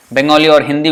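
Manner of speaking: ordinary talk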